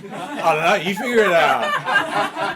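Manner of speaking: loud, high, slow snooty voice; mock snooty watch; passive aggressive watch. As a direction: sneer it like a smug watch. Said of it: "fucking passive aggressive".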